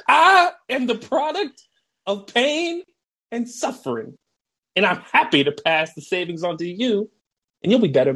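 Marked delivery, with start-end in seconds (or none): 0.1-4.2 coach's tragic speech going falsetto; dramatic coach. Retold or imitated. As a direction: squeak out the tragic backstory.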